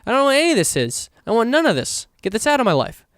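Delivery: high-pitched fed-up outburst; exasperated rant. Retold or imitated. Griping about the book banning plot, high and exasperated. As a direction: pitch way up, throw it all away. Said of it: "I'm like".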